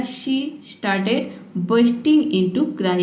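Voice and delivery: slow, careful English reading. Shown as a demonstration. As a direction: spell it out slowly for learners. (धीमे स्वर में पढ़ना)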